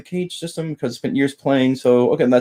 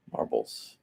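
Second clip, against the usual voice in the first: breathy whispered echo of the name Marbles; whispered repeat; 14 dB quieter than usual. Real, not acted. Teacher whispering a viewer's name while recalling it. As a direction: hush it under your breath as you place it.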